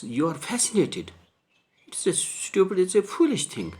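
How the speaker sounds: surprised. Acted. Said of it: "thinks it is surprising".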